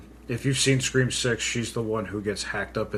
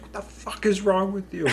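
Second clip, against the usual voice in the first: high-pitched mock yell; imitated outraged yell; 10 semitones higher than usual. Heroine at drivers who won't stop, high mock outrage. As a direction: voice shoots up, imitating her outrage. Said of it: "but the way she does it".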